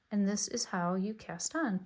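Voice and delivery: ordinary talk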